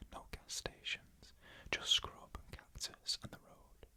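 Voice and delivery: ordinary delivery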